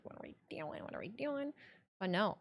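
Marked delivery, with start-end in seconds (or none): 0.0-0.8 breathy whisper to herself; whispered worried thought; whispered inner panic. Retold or imitated. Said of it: "I'm like". 0.8-1.5 quiet voiced fretting; softly spoken worry; soft worried voice. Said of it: "I'm like".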